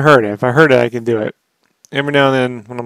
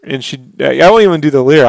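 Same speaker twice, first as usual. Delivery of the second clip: ordinary talk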